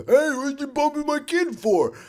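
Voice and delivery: high, pushy, accusing voice; confrontational dad. Retold or imitated. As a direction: get in his face. Angry parent voiced.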